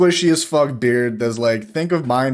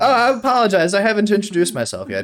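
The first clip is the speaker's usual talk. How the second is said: put-on eager old bard; 7 semitones higher, faster than usual. Old bard introducing himself.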